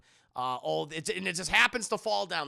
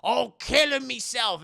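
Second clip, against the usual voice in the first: higher, louder, scolding rant; stern old landlady; 5 semitones higher, 5 dB louder than usual. Retold or imitated. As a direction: warn the new tenant fiercely. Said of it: "landlady lecturing rules".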